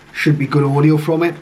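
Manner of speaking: ordinary delivery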